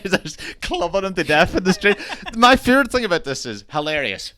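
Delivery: high, real laughter through the words; amused, laughing. Real, not acted. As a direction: relish the absurd scene while cracking up. Laughing over the film scene.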